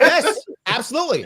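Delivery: laughing "Yes, absolutely" said high and loud. Real, not acted. Laughing at the host's communist joke, high and loud. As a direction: laugh the answer out.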